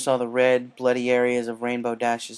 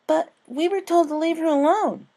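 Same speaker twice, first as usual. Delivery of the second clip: much higher, louder anxious little voice; a timid filly protesting; worried filly; 7 dB louder than usual. A narrator's reading. Sweetie Belle warns friends, high worried voice.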